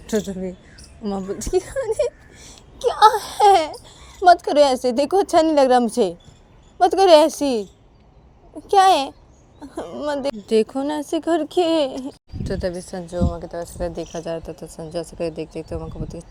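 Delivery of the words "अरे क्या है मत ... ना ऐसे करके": high, loud protest; pleading Uma. Acted. Uma asking Sanju not to stare, high pleading. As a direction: plead in a raised, whiny voice.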